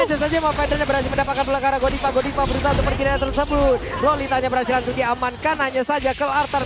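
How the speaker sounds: very fast play-by-play; fast esports caster. Real, not acted. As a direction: rattle off the action quickly. Rapid play-by-play.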